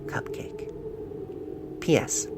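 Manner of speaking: breathy sign-off whisper; barely voiced whisper; whispered signature. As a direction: whisper the name and P S. Signing the letter.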